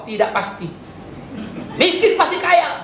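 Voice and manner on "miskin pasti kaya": high, slow mock preacher slogan; mock promise. Pastor mocking prosperity preachers, high and slow. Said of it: "jangan harap dengar khotbah di tempat ini".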